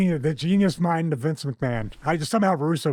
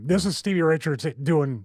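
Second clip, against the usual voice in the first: normal talking voice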